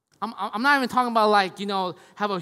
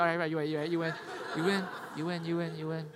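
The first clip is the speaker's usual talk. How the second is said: hurried mock concession, dropping softer and lower; meek surrender; 3 semitones lower, 6 dB quieter, much faster than usual. Husband giving in to his wife, sheepish mock surrender. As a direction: back down, repeating it as it fades.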